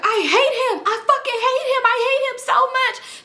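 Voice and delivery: high, hammed-up furious friend; upset friend. Leslie storming out of the room, mock angry and high.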